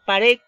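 "Pared" said loud, weight on the final RED; stress on RED. Shown as a demonstration. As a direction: push the second syllable of "Pared". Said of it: "donde lleva la mayor fuerza de voz".

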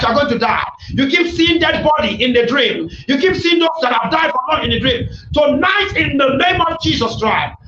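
normal talking voice

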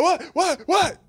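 high, loud bewildered cries; startled confusion. Retold or imitated. Sleeper jolted awake by the prank, high and loud in startled confusion. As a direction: wake up bewildered.

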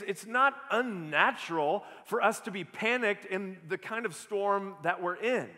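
ordinary delivery

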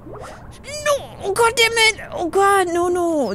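high-pitched cry of dismay; dismayed outcry. Real, not acted. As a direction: cry out as it all goes wrong. Falls while climbing in game.

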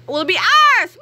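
high, loud villainous cackle; evil kids gloating over their plan; evil laugh. Acted. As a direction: cackle over world domination.

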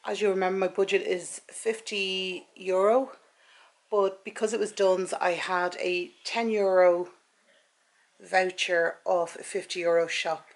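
ordinary talk, nothing marked

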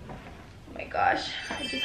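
sudden hushed whisper; startled whisper. Real, not acted. Startled by the doorbell mid-unboxing, whispering. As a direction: whisper it, caught off guard.

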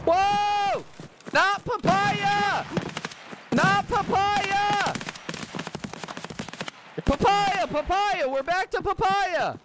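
very high, drawn-out cries; frantic yelling. Real, not acted. Streamer calling the agreed safe word to squadmates, frantic high yell. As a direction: squeal the safe word in alarm.

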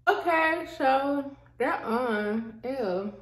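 strips covering teeth, lips pulled back; slightly lisped, careful mouth movement; talking with whitening strips on. Real, not acted. Seen 1: Applies strips at -5 to -4; teeth look coated while talking.

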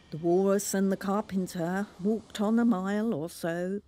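usual speaking voice

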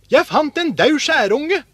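high, loud, eager answer; excited announcement. A narrator's reading. Askeladden shows his brothers a dead magpie, excited. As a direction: show off a find with delight.

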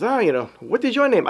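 high, clumsy attempt at his accent; playful bad accent imitation; Brother John's accent. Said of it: "I can't do the accent".

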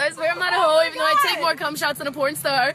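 ordinary talk

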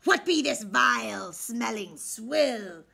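a hint of a gruff pirate, drawn out; disgusted pirate. A narrator's reading. Pirate captain sniffing the baby food in disgust. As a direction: sneer at the smelly food.

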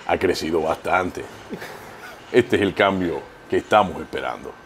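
low, soft politician with long pauses; Abinader impression. Comedian answering host's request, low halting presidential impression. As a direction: speak low and measured, pausing between phrases. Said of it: "¿Cómo es que habla Abinader?".

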